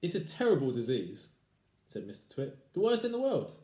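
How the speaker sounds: gloomy husband. Acted. Husband mock solemn.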